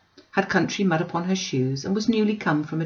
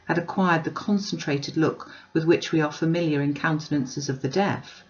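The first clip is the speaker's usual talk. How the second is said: ordinary delivery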